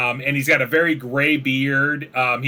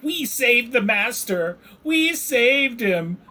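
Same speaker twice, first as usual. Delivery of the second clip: high, slow, squeaky little creature voice; high, slow kobold proudly reporting to the dwarves; 9 semitones higher, slower than usual. Acted. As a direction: pipe it up like an eager kobold.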